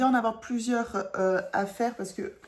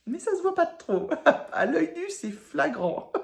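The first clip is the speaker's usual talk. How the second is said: high, louder, through real laughter; amused, laughing; 9 semitones higher, 4 dB louder than usual. Real, not acted. Embroiderer admits her colour mismatch to viewers, laughing. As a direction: giggle helplessly while admitting the flaw.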